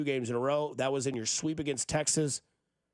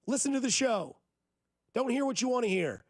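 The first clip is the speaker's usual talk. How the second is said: higher, heated, insistent rant; mad rant; 8 semitones higher than usual. Real, not acted. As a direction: vent real frustration at the critics. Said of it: "I'm just mad".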